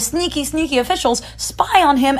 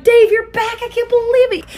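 much higher, loud joyful cry; overjoyed; 11 semitones higher, 4 dB louder than usual. Acted. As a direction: burst out with a father's joy. Father overjoyed at son's return.

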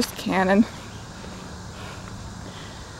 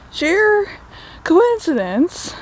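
high, slow mocking sheer coincidence; sarcastic sheer coincidence; sarcastic emphasis; 7 semitones higher, much slower than usual. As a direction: drip irony over sheer coincidence. Mocking the plot convenience.

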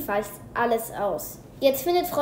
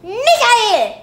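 much higher, loud sharp call of the name; angry call; 10 semitones higher, 13 dB louder than usual. A narrator's reading. Teacher calls the laughing boy to order, angry and loud. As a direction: snap his name out. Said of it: "ruft Frau Scholler böse".

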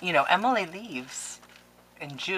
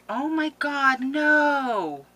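moved gasp; 10 semitones higher, 4 dB louder, much slower than usual. Real, not acted. Moved by the letter.

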